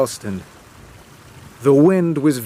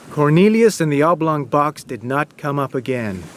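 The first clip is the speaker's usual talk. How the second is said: plain reading voice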